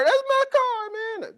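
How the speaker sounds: very high, squeaky comic protest; high-pitched comic indignation; outraged car owner. Pretend. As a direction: yelp it like the guy whose car got taken in Speed. Guy from Speed, car taken.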